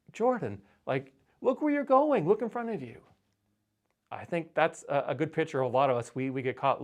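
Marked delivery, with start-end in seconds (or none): 0.1-3.0 much higher, amused exasperated parent voice; half-laughing scold to a clumsy toddler. Retold or imitated. Parent chiding a toddler who hit the post again, high and exasperated. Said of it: "we're kinda chuckling at this point".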